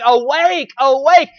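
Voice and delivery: loud, urgent repeated call; crying out. Acted. Voice of love calling the lost person, crying out loudly. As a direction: startle a sleeper awake with it.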